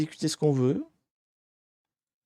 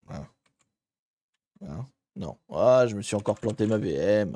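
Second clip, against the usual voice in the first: ordinary delivery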